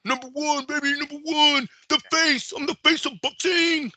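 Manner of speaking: very high mock boast, strutting; mocking the fighter. Host parodying the fighter's boast to co-host, very high and strutting. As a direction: crow it like a cocky champ showing off. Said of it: "And they get rewarded for it".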